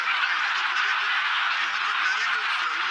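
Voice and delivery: lightly put-on Welsh voice; mock Welsh accent. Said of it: "I did a little bit of Welsh".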